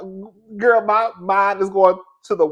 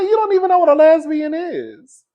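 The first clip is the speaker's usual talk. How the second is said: higher, louder disbelief; incredulous; 13 semitones higher, 6 dB louder than usual. Retold or imitated. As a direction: challenge her in disbelief. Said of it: "I said".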